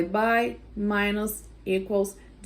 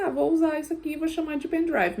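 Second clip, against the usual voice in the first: higher, quick mock inventor voice; imitating a Brazilian; 7 semitones higher, faster than usual. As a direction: play the Brazilian who coins pendrive. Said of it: "um brasileiro que falou assim".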